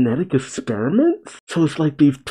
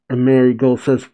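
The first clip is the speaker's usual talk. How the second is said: usual speaking voice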